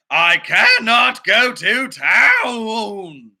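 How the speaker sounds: slow, whimpering whine; milk it like a pony near tears; stubborn pony wailing to the farmer; whiny fake crying.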